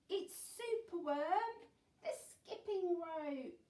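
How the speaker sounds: high, loud, bouncy read-aloud; animated story reading. A narrator's reading. Teacher reads the rescue rhyme to children, animated storytelling. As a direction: build to a big, drawn-out reveal.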